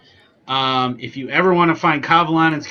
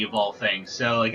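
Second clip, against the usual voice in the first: ordinary talk, nothing marked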